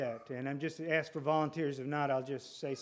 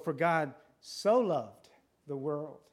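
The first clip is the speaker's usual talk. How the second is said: slow, "so" stretched and raised; stress on SO; slower than usual. Shown as a demonstration. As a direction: dwell on the word so, unhurried. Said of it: "put the emphasis on different parts of the verse".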